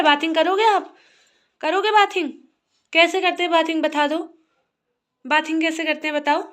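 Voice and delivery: loud, high questions to a little one. Real, not acted. Mother asking child about bathing, loud high baby-talk. Mother playfully quizzing toddler.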